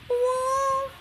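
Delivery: high cutesy puppy woof; dog woof. Shown as a demonstration. Mom echoing her toddler with a high woof. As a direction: bark like a tiny dog. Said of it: "What did the dog say?".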